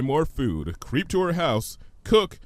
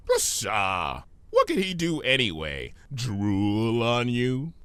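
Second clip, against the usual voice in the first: smooth trickster voice; slower than usual. Acted. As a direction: play a smug chaos spirit. Discord voiced in story.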